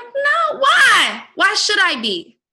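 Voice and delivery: loud, high, exasperated pushback; loud, high, fed-up rant; heated retort. Retold or imitated. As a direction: push back hard and refuse to back down. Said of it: "and I'm like".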